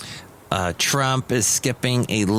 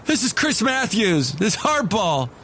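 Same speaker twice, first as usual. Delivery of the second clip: high, punchy TV host impression; mock political host intro, pitched high; Chris Matthews; 9 semitones higher than usual. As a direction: announce the show in a pitched-up caricature. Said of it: "That's my little Chris Matthews impression".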